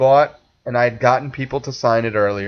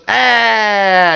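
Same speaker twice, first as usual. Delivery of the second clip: disdain; 7 semitones higher, 3 dB louder than usual. Retold or imitated. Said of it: "George looks at him with disdain and goes".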